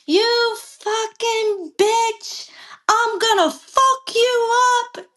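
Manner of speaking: high, drawn-out mock threat; retold angry cursing. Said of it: "he's cursing me out".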